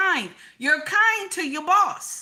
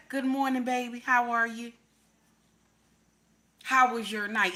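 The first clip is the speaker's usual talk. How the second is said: sweet morning greeting; 2 semitones lower than usual. Shown as a demonstration. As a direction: coo it gently like a loving wife. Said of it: "You got to learn how to say".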